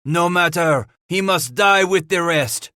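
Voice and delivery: high, loud angry retort; growled. Acted. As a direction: spit it out in anger. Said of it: "Henri growled".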